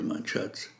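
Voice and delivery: whispered pet name; a soft, tender whisper to her. A narrator's reading. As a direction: breathe it softly and lovingly.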